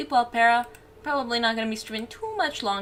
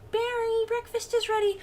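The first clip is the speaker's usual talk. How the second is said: much higher mom voice calling upstairs. Acted. Bee mom calling Barry to breakfast, high calling voice. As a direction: call your son down for breakfast.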